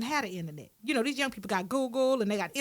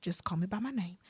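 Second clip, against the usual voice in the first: hushed, softer aside, mostly whispered; whispered warning; 6 semitones lower, 4 dB quieter, faster than usual. Retold or imitated. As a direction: pull her close and warn her quietly. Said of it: "I was like".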